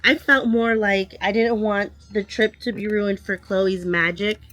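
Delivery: ordinary talk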